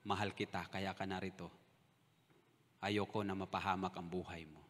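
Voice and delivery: low, soft and gentle; tender divine voice. Retold or imitated. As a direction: speak softly as God comforting. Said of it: "Sabi niya sa akin".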